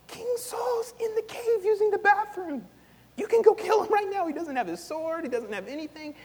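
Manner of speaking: high, eager voice of David's men urging him on; excited urging men. Retold or imitated. David's men urging him to kill Saul, eager and high. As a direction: hype him up to strike.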